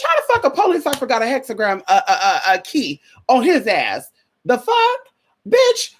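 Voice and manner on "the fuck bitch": shocked disbelief. Real, not acted. Shocked outburst at officer's key.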